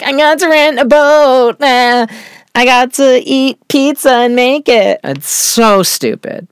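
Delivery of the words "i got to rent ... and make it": much higher, mock-giddy put-on voice; Lydia voice. Host mocking Lydia showing off boat and pizza, high giddy voice. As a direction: play Lydia as a giddy, over-eager girl, voice pitched way up. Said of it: "it's like Lydia".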